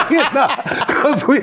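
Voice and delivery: laughs high and loud through the words; real laugh spilling into the talk. Real, not acted. Laughs at own mock swoon.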